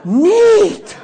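put-on the dazed young man, overjoyed, exclaims neat through a laugh; awestruck. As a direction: burst out in dazed delight. Awestruck man after prayer.

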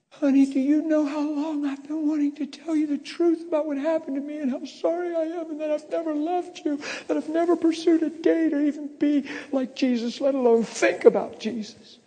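high-pitched tearful outpouring; retold crying. Crying husband confessing to his wife, imitated tearful voice. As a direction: confess it all in a high, weepy voice. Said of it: "Now I'm crying".

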